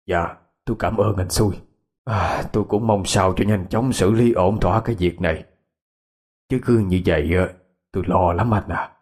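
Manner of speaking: put-on a low, worried elderly voice; an old man admitting he is afraid; anxious old man.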